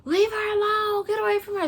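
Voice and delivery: very high, urgent holler; imitating bystanders shouting someone off; high strained mock shout; acted rescuers' yell. Bystanders rush over to rescue her.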